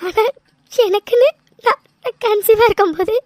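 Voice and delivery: normal talking voice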